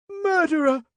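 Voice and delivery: very high, loud woman's cry. A narrator's reading. Woman at the corner accuses the inspector, crying out. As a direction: shriek the accusation across the street. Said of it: "cried a woman from the corner".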